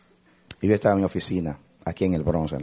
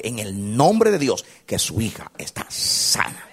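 slow solemn proclamation; 4 semitones higher, 5 dB louder, slower than usual. Acted.

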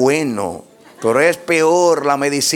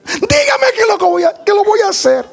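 high-pitched mock tearful plea; desperate pleading; 4 dB louder than usual. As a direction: imitate the distraught woman begging for an answer. Imitated desperate woman begs pastor. (súplica desesperada y aguda, imitada)

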